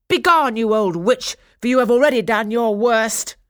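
loud, high, forceful command; commanding banishment. A narrator's reading. Lilac fairy orders Caraboss away, loudly commanding. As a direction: banish her with ringing authority.

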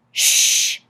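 loud, sharp "shh"; commanding shush. A narrator's reading. Husband silencing wife to hear the radio, commanding shush. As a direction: hush the room with one long hiss. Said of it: "commanded Mister Popper".

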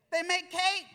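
loud, high answer to his own question; emphatic punchline. Real, not acted. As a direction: hit it big and obvious. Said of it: "punchline to congregation".

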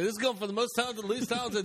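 high, fast mock quote; a hint of a squeaky mocking voice. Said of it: "I didn't say".

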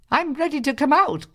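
high, polite little bread voice; sweet high plea from the oven; talking bread voice. A narrator's reading. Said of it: "cried the bread".

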